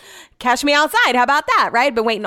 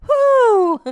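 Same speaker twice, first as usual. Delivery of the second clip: cheer; 14 semitones higher, 5 dB louder than usual. Real, not acted. Mock celebratory cheer.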